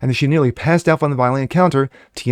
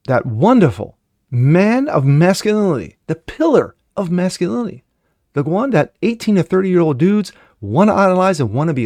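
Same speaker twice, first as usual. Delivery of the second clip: sarcastic praise; 6 semitones higher than usual. Real, not acted. Vlogger mocking Andrew Tate with sarcastic praise. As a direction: lay the fake admiration on thick.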